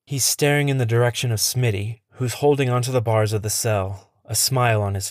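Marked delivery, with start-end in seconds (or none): none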